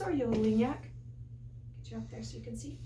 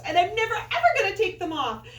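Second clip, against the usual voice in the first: high, loud insistence on never; excited vow; 8 semitones higher, 6 dB louder, faster than usual. A narrator's reading. Tina insisting to her mom, excitedly. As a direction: declare it like a stubborn kid. Child vows never removing socks.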